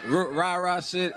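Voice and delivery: usual speaking voice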